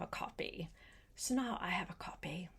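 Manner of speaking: quick, hushed confiding whisper. Real, not acted. Telling Gretel the book is now her own, hurried whisper. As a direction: let her in on a little secret, whispered fast.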